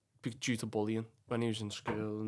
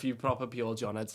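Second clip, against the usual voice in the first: ordinary talk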